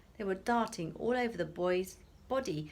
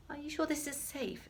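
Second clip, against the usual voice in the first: anxious mother; 8 semitones higher than usual. Acted. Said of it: "a look of panic".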